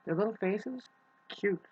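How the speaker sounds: little high-pitched gush. Real, not acted. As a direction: coo over tiny kitten faces. Cooing about kittens' faces.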